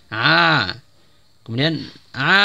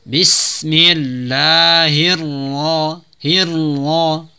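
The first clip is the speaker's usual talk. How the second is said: very slow melodic recitation of Bismillahirrahmanirrahim; Quran recitation; much slower than usual. Shown as a demonstration. Teacher models full recitation, slow melodic chant. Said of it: "kemudian ra ditebalkan".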